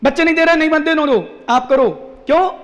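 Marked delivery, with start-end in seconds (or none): none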